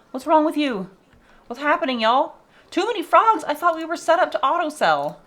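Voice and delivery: high alarmed gasp and questions; suddenly thrown, voice jumping up; alarmed surprise. Real, not acted. Streamer spots overcrowded frogs, alarmed and high.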